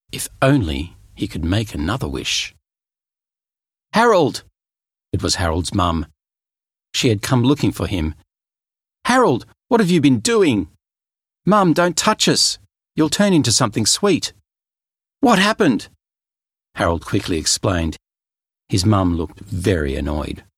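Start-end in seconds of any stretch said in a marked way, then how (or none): 3.9-4.4 loud, high call for her son; mum calling out. Acted. Mum calls out. 9.1-10.7 higher, louder scolding mother; annoyed mum. Acted. 11.5-14.3 urgent warning. Acted. Boy warns mum. 15.2-15.9 alarmed mum. Acted. Mum asks.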